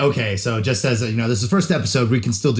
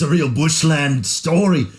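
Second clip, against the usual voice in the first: slow, mock Australian bushland drawl; Australian accent; 4 semitones higher, slower than usual. Shown as a demonstration. A podcaster tries an Aussie accent on his co-host. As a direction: lay on an Australian accent, slowly. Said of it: "say it with, like, the accent?".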